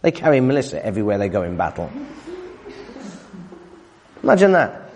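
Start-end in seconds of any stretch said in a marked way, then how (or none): 4.2-4.9 emphatic amazement. Acted. Preacher stressing astonishment.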